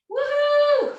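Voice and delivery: high loud whoop; excited whoop. Real, not acted. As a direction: cheer at the finish. Coach cheering end of set.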